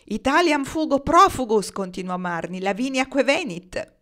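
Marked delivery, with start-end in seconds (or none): 0.1-1.7 high, loud Latin declamation; grand, projected recitation; declaiming verse. A narrator's reading. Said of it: "in questo momento sta declamando". 2.6-3.9 high, slow Latin declamation; projected epic recitation; declaiming verse. A narrator's reading. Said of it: "in questo momento sta declamando".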